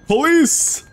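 high, loud call for help. Real, not acted. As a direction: call out loudly for the police. Gamer calls out on ringing phone.

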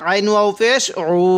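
usual speaking voice

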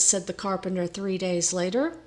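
ordinary delivery

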